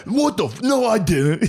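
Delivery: high, shouted flustered denial; startled denial. Retold or imitated. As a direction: blurt it out caught red-handed. Bartender caught, spazzed denial.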